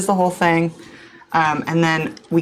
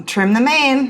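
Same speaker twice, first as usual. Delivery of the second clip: higher salty sailor call; sailing crew shouts in wind; 7 semitones higher, slower than usual. Acted. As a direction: call it out like an old sea hand. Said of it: "[name]'s saying cool ship stuff like".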